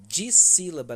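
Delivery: "Dissílaba" bem mais alto e mais agudo; anunciando em voz alta. Shown as a demonstration. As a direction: anuncie "Dissílaba" com força para a turma. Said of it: "lembra dois". (professora anunciando em voz bem alta)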